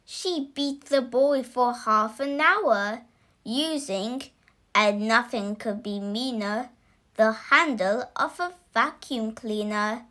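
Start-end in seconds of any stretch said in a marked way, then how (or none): none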